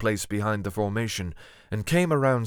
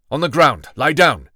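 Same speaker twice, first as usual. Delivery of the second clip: loud, firm command; ordering; 5 semitones higher, 10 dB louder than usual. A narrator's reading. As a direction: order him down with no room to argue. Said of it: "he ordered".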